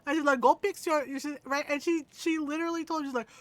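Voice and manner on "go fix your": put-on loud, higher Filipino accent; Filipino mother. Filipino mom ordering her son, loud accented command. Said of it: "our little tiny Filipino mother".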